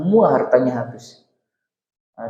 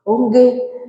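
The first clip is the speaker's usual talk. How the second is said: mock eager wife; 10 semitones higher than usual. Wife answering husband in story.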